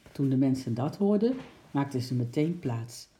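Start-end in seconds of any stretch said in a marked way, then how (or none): none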